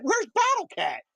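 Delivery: a kid asking, voiced high; son's voice.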